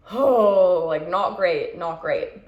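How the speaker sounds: loud groaning "Oh", then rueful; hungover groan. Retold or imitated. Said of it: "been like".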